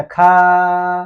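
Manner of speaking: खा stretched loud and endlessly; overlong खा. Shown as a demonstration. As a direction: exaggerate खा on one held pitch. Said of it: "करते रह जाना।". (खा बहुत लंबा खींचकर)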